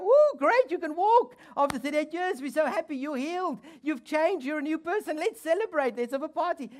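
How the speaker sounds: high, loud, gushing mock cheer; mock celebration. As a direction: gush like overjoyed neighbours. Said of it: "Not everybody's gonna go".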